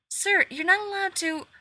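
high, loud startled protest; alarmed outburst. Acted. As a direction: blurt it out in alarm. Nurse stops stranger, alarmed.

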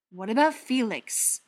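insistent challenge; pressing. A narrator's reading. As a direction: press the point firmly. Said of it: "Butcher pressed".

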